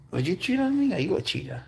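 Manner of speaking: high breathy coo to the cat; whispery pet talk. Real, not acted.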